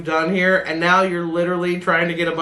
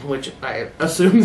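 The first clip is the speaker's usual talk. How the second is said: normal talking voice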